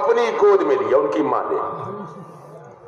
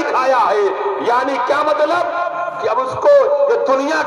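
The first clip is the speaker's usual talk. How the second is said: forceful preaching; 6 semitones higher than usual. Acted. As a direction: drive the lesson home to the crowd. Preaches the lesson at full force. (پرجوش خطیبانہ انداز)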